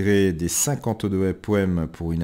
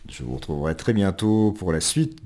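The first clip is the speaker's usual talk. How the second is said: usual speaking voice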